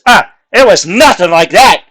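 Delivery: put-on half-drunk guy loudly waving it off; indignant shout. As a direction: bellow it as a drunk brushing off the question. Drunk human denies the story.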